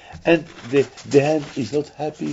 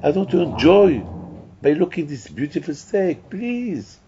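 high, louder plea; pleading eyes; 7 semitones higher, 3 dB louder than usual. Pretend. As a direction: beg high and urgent, refusing to look. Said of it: "And the eyes are screaming".

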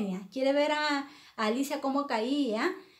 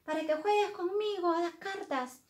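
high-pitched imperious storybook queen; angry shouting queen; 8 semitones higher than usual. Acted. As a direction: summon the girl crossly, pitched way up. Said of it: "muy, muy enfadada, gritando".

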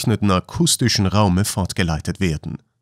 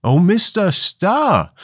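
high, slow, delighted cry of recognition; surprised exclamation; 10 semitones higher, slower than usual. A narrator's reading. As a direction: cry out on recognising an old superior. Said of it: "rief Jack Ryan". (hoch und freudig überrascht ausgerufen)

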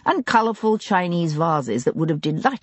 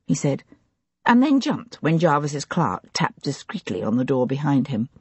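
ordinary delivery

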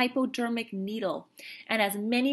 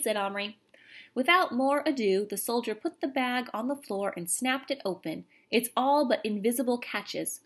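plain reading voice

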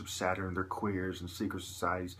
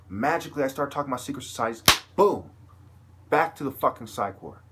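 louder and higher, fast, exploding on boom; furious rant climbing to a shouted boom; heated outburst; 5 semitones higher, 9 dB louder than usual. Real, not acted. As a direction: build fast to an angry boom. Ranting about being recommitted.

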